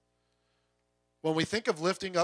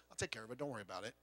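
low, soft, quick aside; casual reassurance; 7 semitones lower, 14 dB quieter, much faster than usual. Retold or imitated. God answering people afraid it will fall apart, quietly reassuring. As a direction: toss off the comfort, hushed and fast.

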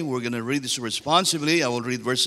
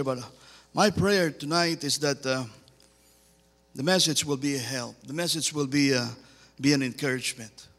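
normal talking voice

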